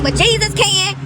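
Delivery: high, loud ecstatic cry; excited cry. Real, not acted. Repeating to her viewers that Jesus can, a high loud excited cry. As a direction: shout it up high with joy.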